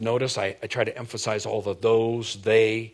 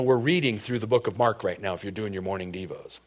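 ordinary talk, nothing marked

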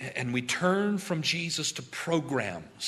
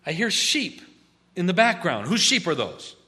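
louder, higher, pointed accusing question; accusing prophet; 5 semitones higher, 7 dB louder than usual. Acted. Samuel catching Saul with the sheep, loud accusation. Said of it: "Why is it?".

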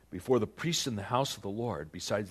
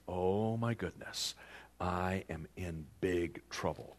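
slow, lower, understated dread; sinking realization; 5 semitones lower, 6 dB quieter than usual. Acted. King realizing God has struck him, sinking realization. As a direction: let the mild dread sink in slowly.

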